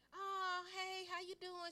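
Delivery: high, sugary phone greeting; butter him up before asking; sister's sweet greeting. Retold or imitated. Sister sweetly greets her on the phone. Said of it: "sister voiced on phone".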